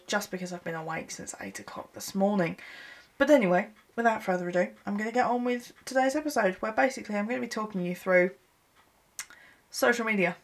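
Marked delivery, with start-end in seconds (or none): none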